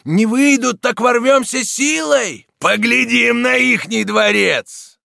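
high, slow, loud battle cries; crowd shouting. A narrator's reading. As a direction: yell it as one of the fired-up mob. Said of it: "кричала толпа".

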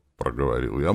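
ordinary delivery

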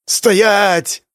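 loud high command; shout. Acted. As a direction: bark the order at deserters. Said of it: "закричала Виолетта".

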